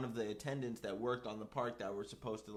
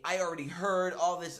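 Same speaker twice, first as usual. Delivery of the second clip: raised, higher voice cutting him off; imitating exasperated mom; 7 semitones higher, 8 dB louder than usual. Mom answering her fired kid, imitated exasperated. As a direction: loudly wave the news away, as retold.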